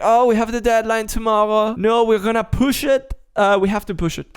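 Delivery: mock employee with a slipping French accent. Imitating staff facing a deadline, in a French accent. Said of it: "I'm speaking with a French accent".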